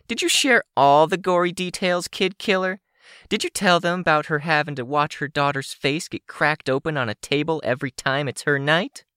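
high-pitched, accusing villainess; high, indignant character voice; angry villain woman. Acted. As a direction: hurl accusations in a high, hostile voice. Sidepiece accuses Rain.

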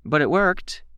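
high, bright and upbeat. A narrator's reading. Candy answering Darlene about the puppy therapy, bright. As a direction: pipe up brightly. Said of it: "her tone bright".

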